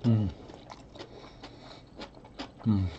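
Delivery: humming while chewing; muffled closed-mouth murmur; mouth full, chewing. Real, not acted. Seen 0: cheeks puffed, lips closed, chewing in every labelled frame.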